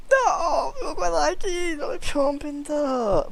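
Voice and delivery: high, laughing, drawn-out whine. Real, not acted. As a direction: laugh in mock despair. Laughing over missed penta.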